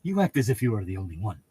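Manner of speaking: fast, soft mock-snooty aside; quick sneering imitation; mock Puss. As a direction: toss off a snide jab. Mocking quote of Puss.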